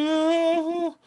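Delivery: high, loud hammed-up "Oh"; mock scared high "Oh"; mock startled gasp. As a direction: jump at a sound that isn't there. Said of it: "I was like".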